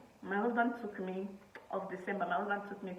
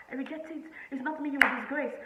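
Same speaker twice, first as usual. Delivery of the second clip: high, louder mock-outraged mom; hammed-up scolding Nigerian mother; 8 semitones higher, 8 dB louder than usual. Imitating her mom scolding her about disgrace, high and loud. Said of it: "You know, Nigerian mothers".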